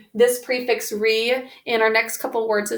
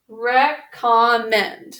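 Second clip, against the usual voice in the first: slow whole word; 3 dB louder, much slower than usual. Shown as a demonstration. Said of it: "sounding out review".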